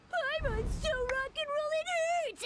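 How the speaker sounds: high-pitched imitation of a yell; mock yell. Paimon imagines Ito yelling in defeat, mock high yell. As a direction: cry it out, imitating an overwhelmed rival. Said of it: "Pretty sure he'd yell out".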